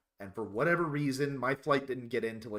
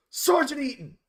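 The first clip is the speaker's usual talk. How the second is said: loud, high shout of a name; yelling a name; 12 semitones higher, 8 dB louder than usual. Retold or imitated. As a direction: bark the name across the field. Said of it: "and just goes".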